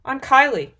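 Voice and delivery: high, loud self-introduction; confident. A narrator's reading. Heroine giving her alias to doubters, confident. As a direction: announce yourself boldly. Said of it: "Kyra said with confidence".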